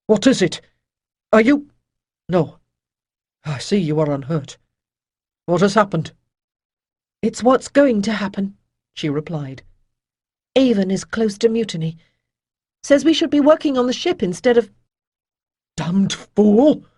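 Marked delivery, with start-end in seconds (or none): 0.1-6.1 alarmed. A narrator's reading. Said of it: "eyes widening in alarm". 7.2-8.6 urgent. A narrator's reading. Demisa reporting urgent news. 10.5-14.7 high, fast and breathless; urgent warning. A narrator's reading. Demisa warning of mutiny. 15.8-16.8 loud angry curse. A narrator's reading. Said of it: "a dangerous glow lit Rydell's eyes".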